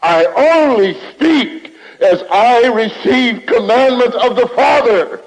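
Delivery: building preaching cry. Real, not acted. Preacher insisting Jesus would not change his words, rising to a cry. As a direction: pour it out until the voice cracks.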